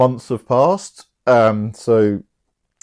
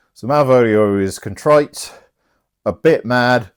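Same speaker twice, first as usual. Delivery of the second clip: normal talking voice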